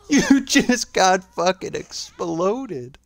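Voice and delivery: high, drawn-out squeal through laughter; laughing disbelief. Real, not acted. Spectator to the player who got exploded, laughing hard. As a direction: say it slowly, high, cracking up.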